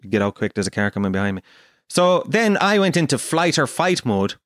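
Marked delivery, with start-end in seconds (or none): 0.1-1.4 low, rapid deadpan order; curt taxi driver. Retold or imitated. Taxi driver hurrying passenger out for the car behind, curt. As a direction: imitate a brusque driver rattling it off.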